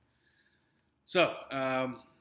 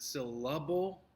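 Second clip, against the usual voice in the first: "syllable" said syl-LA-ble, rising as a question; stress on middle syllable; 2 semitones higher, 3 dB louder, slower than usual. Shown as a demonstration. Teacher tests a wrong option on the class, "syllable" stressed syl-LA-ble. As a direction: punch the second syllable of "syllable", questioningly. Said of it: "Which one is stressed".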